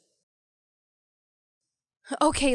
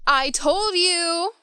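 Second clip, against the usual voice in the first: high, slow and gleeful; drawn-out, delighted gloating; triumphant I-told-you-so; 7 semitones higher, 4 dB louder, much slower than usual. Acted. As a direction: stretch it out in triumph. Friend gloats over correct prediction.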